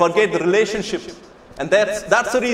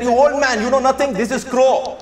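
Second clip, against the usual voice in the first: raised, higher-pitched exasperated son; losing temper; 4 semitones higher than usual. Retold or imitated. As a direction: snap mildly at an old father, acted. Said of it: "you lose temper".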